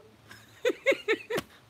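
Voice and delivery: real high cackling laugh; high laughter. Real, not acted. Telling viewers about the checkout, high loud laugh.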